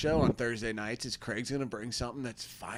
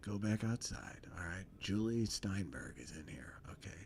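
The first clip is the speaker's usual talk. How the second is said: low, soft voice sinking toward a whisper; confidential, slowing down at the end; hushed confiding mentor in a scene; 7 semitones lower, 10 dB quieter than usual. Acted. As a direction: play a mentor quietly sharing a secret with a kid.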